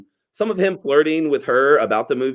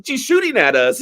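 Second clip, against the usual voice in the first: mock panic; 13 semitones higher than usual. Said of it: "He's like".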